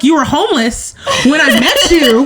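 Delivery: mock scolding the cat through laughter; laughing reproach to the cat. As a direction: tease her while cracking up. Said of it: "And I'm like".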